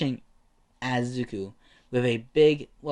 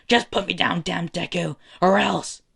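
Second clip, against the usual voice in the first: raised, higher acted rant; angry ranting; 6 semitones higher, 4 dB louder than usual. Stuck bully threatening his rival to put him down, ranting. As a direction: rant out a threat, building loud at the end. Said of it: "kept on ranting".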